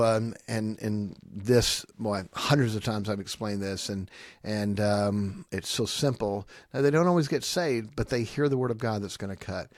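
ordinary talk

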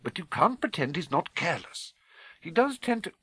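reluctant, high-pitched objection; grudging. Acted. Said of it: "said Professor McGonagall grudgingly".

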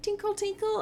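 high-pitched little tinkle; imitated tinkling. Game master imitates rattling handcuffs, high tinkling. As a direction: chime the word lightly up high. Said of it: "like, a rattling noise".

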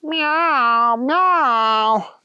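long, drawn-out high meows; meowing strange cat. Acted. Strange cat answering Thunder Kitty, meowing. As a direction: meow back slowly like a cat. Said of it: "The other kitty looked at Thunder Kitty".

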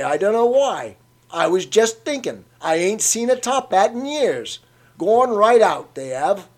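put-on an old man delighted to remember; chatty old Cockney. A narrator's reading. Old man reminiscing about top hats.

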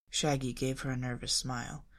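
ordinary delivery, nothing marked

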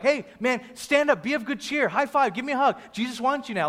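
high, eager cheering-on; hammed-up enthusiastic welcome; suddenly friendly crowd. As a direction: gush as a fickle new fan. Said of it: "switched one eighty from hating him to loving him".

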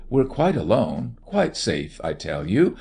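ordinary delivery, nothing marked

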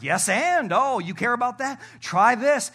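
loud mocking tempter; devil voice. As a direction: goad them like the devil piling on. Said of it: "He's like".

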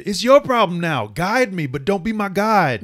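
high, loud, put-on voice; in-character demand. Acting out the character's demand, high and forceful.

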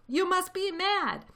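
high, loud, slow jeer. A narrator's reading. Crowd jeering at the farmers in the crater, mocking. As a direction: draw it out high in disbelief.

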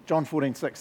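ordinary delivery

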